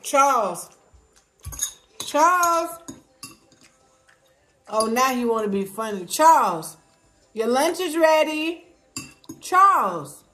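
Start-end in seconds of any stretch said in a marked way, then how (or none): 0.0-2.7 calling out. Real, not acted. Calling the dog. 6.1-10.2 louder, higher call across room. Real, not acted. Calling the dog to lunch.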